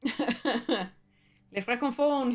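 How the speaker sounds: put-on French accent with a laugh just before; attempted French accent. Streamer greeting French speakers, attempted French accent. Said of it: "pronounce it with my best French accent".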